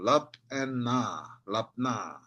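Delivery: "lap" and "na" split, then joined "lapna"; two syllables. Shown as a demonstration. Teacher explains syllables, splitting then joining "lapna". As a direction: separate "lap", "na", then low falling "lapna". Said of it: "then it becomes two syllable".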